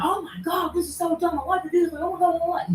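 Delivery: high, whiny mock teen complaint; squeaky imitation of griping kids; mocking whiny students. Mimicking her students whining at her in class. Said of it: "yelling at me".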